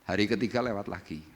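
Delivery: normal talking voice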